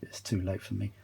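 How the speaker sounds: usual speaking voice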